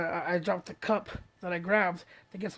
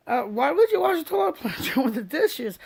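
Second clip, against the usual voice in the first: incredulous question; 6 semitones higher, 5 dB louder than usual. Retold or imitated.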